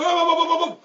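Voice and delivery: high, loud repeated halt; urgent calling out. Acted. Ferry worker halting a car, urgently calling out. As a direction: stop the car with your voice. Lane marshal calling to drivers.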